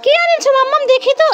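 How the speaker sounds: put-on high, eager little child asking mummy. Mother voicing her child asking mummy for a present, high childish voice. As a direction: pitch up into a small kid's excited question.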